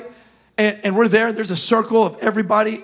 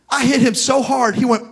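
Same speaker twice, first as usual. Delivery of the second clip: ordinary talk, nothing marked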